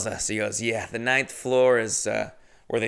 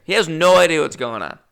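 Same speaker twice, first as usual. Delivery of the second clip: normal talking voice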